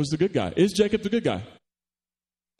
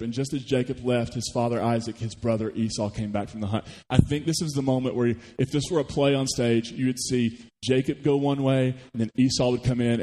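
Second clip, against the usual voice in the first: ordinary delivery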